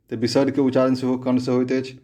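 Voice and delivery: ordinary delivery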